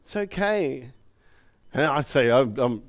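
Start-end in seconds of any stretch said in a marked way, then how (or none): 0.0-0.9 softer, gentle reassurance; calm a crying child; soothing. Retold or imitated. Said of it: "and say".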